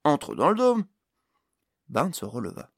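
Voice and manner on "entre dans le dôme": high, loud urging; loud acted exclamation; exclaiming. A narrator's reading. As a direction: egg him on with an excited call. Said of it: "s'exclama Pod".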